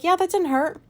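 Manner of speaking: high, forced cheerful brush-off; fake breezy denial. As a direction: pretend it was nothing, brightly. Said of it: "I smiled and I was like".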